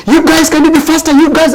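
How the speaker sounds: high-pitched mock shout, hurrying the team; imitated shouting. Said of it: "they were shouting".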